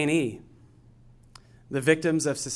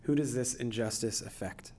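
guided meditation; 8 dB quieter than usual. Acted. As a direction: gently guide them into stillness. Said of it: "I'm gonna take us through a guided meditation".

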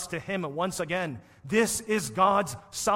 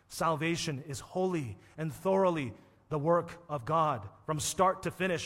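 usual speaking voice

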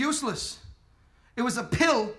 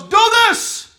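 loud, raised, commanding bark; the law barking orders from behind; stern command; 7 semitones higher, 6 dB louder than usual. Retold or imitated. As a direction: order it like a strict master. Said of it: "The law ... goes".

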